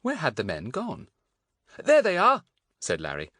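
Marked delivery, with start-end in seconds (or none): 1.8-2.4 loud, high exclamation; excited calling out. A narrator's reading. Larry spotting the men at the corner, calling out excitedly.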